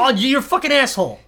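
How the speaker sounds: high, loud playful outrage; yelling at the cohost in jest; mock furious yell. Shouting at cohost in mock fury, high and loud.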